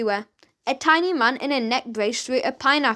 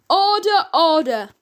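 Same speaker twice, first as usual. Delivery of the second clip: loud shout of "Order, order!"; calling for order; 5 semitones higher, 5 dB louder than usual. A narrator's reading. Judge calling a rowdy court to order, loudly. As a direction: bang it out over the noise.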